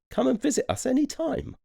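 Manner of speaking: sing-song goodbye from the hippos. A narrator's reading. Hippos inviting the elephants, high friendly voice.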